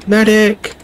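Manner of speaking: high yelled call; shouting for a medic. Real, not acted. Calling for medic in battle.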